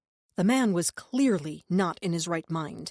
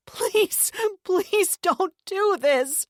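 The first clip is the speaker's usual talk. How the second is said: high, loud, very slow wail; sobbing cry; 13 semitones higher, 6 dB louder, much slower than usual. A narrator's reading. Sister sobbing, begging her brother. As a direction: cry out through sobs. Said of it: "she cried out, sobbing harder".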